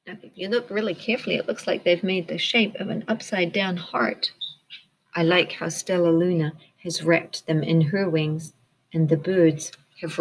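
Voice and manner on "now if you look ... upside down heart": usual speaking voice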